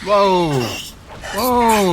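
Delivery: loud, high startled cry; startled whoa. Real, not acted. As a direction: yelp in surprise. Sudden attack in camp.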